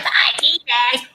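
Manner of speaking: slow, drawn-out imitation of shock; mock disbelief. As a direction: stretch each word in exaggerated astonishment. Mimicking everyone's shocked reaction.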